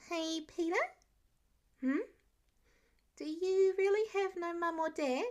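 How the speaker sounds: high, innocent kindergartner question; a child asking without a filter; curious little boy. Acted. Curious child asks big question.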